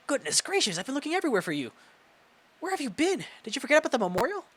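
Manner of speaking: high, fussy scolding voice, fast at the end; an old headmistress flapping in alarm; flustered principal. Acted. Principal scolding the students behind the curtain, flustered high voice.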